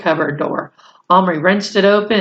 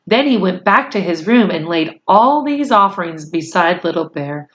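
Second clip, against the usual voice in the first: usual speaking voice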